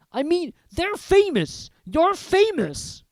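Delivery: stunned giant insisting; astonished Hagrid. Acted. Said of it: "he said".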